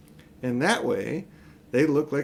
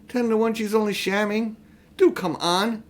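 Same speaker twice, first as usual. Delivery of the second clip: high, impatient urging; impatient girl; 8 semitones higher than usual. A narrator's reading. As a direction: hurry him, dismissive. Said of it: "said Polly".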